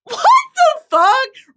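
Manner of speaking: high, loud, drawn-out disbelief; can't believe it, stretched out; incredulous outburst. Real, not acted. Reacting to the casting.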